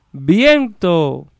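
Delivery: high, long-held word; echo. Acted. Echo returning the carter's last word, drawn out. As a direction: stretch it out like a far-off echo. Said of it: "el eco es todo".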